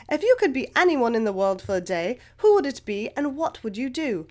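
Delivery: attempted English accent, reading a question; best English accent. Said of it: "in my best English accent".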